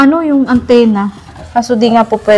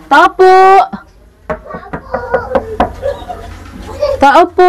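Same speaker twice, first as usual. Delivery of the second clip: calling from far away; 7 semitones higher than usual. Real, not acted. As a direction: call out to whoever is inside. Calling at a closed house.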